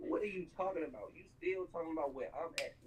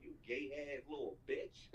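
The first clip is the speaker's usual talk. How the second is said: normal talking voice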